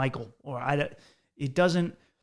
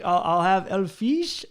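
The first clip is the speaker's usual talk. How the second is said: higher, louder mock Spanish accent; fake Spanish accent; 6 semitones higher, 5 dB louder, slower than usual. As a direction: order in a slow, put-on Spanish accent. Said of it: "like I had a Spanish accent".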